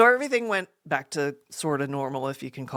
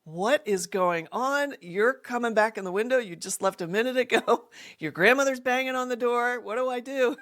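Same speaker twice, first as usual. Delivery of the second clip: higher-pitched mock alarm; retold fretting, pitched up; panicked friend; 7 semitones higher than usual. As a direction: imitate a friend in a mild panic. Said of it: "And poor Linda's like".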